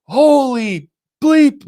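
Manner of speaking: drawn-out high mock shout of shock; slow, high retold outburst; retold shocked exclamation. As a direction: stretch it out high, reliving the shock. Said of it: "I just screamed".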